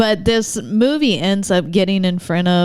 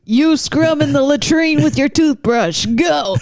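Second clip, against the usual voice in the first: drill sergeant; 7 semitones higher than usual. Pretend. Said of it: "I'll be a captain".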